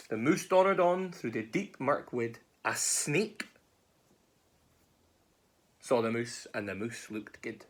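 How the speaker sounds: animated Scots storytelling with swooping tune; story narration. A narrator's reading. Narrator following the mouse through the wood, animated storytelling. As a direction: read it to children, playing up the rhythm.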